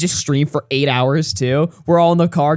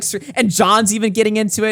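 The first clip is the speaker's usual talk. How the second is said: ordinary delivery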